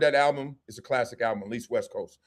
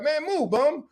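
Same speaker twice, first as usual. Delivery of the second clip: higher-pitched brush-off; mock heckling; 9 semitones higher, slower than usual. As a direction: shoo someone away in a mock quote. Acts out hassling homeless people.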